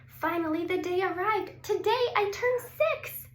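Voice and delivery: put-on high, bright little girl voice; child's birthday excitement, high and loud; excited girl. A narrator's reading. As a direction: squeal it like a six-year-old on her birthday. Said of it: "Sophie said".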